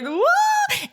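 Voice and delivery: high shrieked what of disbelief; shocked what. Retold or imitated. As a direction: shriek it in over-the-top shock. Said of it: "and she's like".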